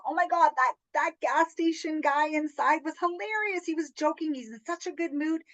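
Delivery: retold amused gushing. Retelling a customer's gas station encounter, amused gushing. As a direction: gush it like you're retelling her delight. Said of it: "she was laughing".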